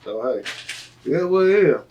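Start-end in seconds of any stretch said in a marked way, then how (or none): none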